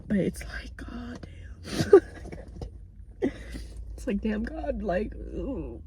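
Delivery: hushed, breathy whisper of real upset; whispered frustration. Real, not acted. Vlogger whispering her frustration about family in the car. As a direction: let the anger drop to a whisper.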